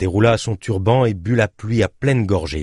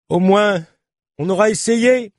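high, raised voice of regret; sorry voice; 13 semitones higher, 4 dB louder than usual. Acted. Cyclist to the journalist after the failed attempt, sorry raised voice. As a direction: call out an apology for a failed attempt. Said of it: "fit-elle d'une voix désolée".

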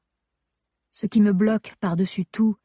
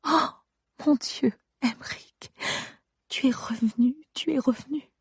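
overwhelmed whisper; 6 semitones higher, 5 dB quieter than usual. Acted. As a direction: whisper in tearful relief. Husband wakes from coma.

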